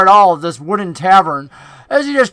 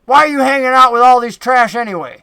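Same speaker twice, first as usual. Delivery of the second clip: higher-pitched southern goblin scorn; lightly voiced southern goblin, pitched up; Finch, southern accent; 5 semitones higher, 4 dB louder, faster than usual. Goblin questioning Clack about his company, southern-accented disdain. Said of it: "the goblin from the north with the southern accent".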